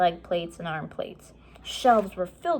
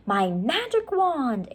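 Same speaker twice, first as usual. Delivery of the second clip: put-on higher, slow girl's voice, grandly; Annie bluffing; 6 semitones higher, slower than usual. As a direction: present the flashlight like a magic wand. Annie bluffing guards with flashlight.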